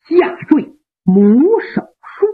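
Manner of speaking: ordinary delivery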